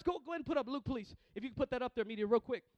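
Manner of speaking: ordinary delivery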